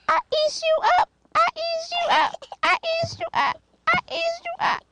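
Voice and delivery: high squeaky toy voice; be a playful squeaky toy monster; rubbery high squeaky toy. Pretend. Playing toy animals with child.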